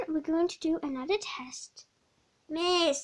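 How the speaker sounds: usual speaking voice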